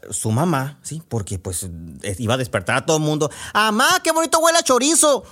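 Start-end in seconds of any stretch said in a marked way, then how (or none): 3.5-5.2 much higher, louder call to mom; sleep shouting girl. Acted. Sleeping girl calling to her mom about chorizo, loud. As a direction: yell it out in her sleep, dreamy and greedy. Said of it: "Y gritaba".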